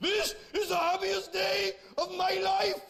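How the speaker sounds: very high, slow, anguished Welsh wail; hammed-up Welsh voice, wailing as if in torment; pissed-off Welsh groom. Welsh groom to his bride on his wedding day, mock anguished Welsh wail. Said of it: "he sounds pissed off".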